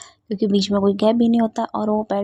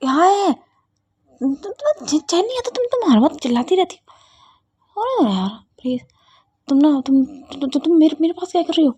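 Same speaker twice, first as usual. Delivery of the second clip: scared shout; 6 semitones higher than usual. Acted. Siya finds Ruhi on top of her, frightened shouting. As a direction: shriek and stammer, badly startled. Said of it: "वो चिल्लाती है".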